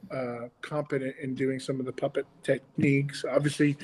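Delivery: usual speaking voice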